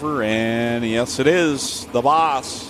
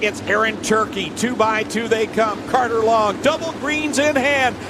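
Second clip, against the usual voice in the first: announcer; 5 semitones higher than usual. Real, not acted. Reads the starting lineup.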